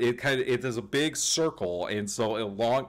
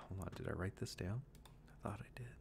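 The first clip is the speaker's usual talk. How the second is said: muttered to self; 16 dB quieter, much faster than usual. Real, not acted. GM mutters while searching notes.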